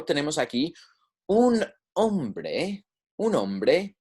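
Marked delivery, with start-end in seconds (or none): 1.3-2.8 "un", then "hombre", drawn out and higher; slow, separated words. Shown as a demonstration. Teacher presenting the example to learners, slow and separated. 3.2-3.9 "un hombre" linked as one phrase; connected, quicker. Shown as a demonstration. Teacher repeating the example for learners, words joined up.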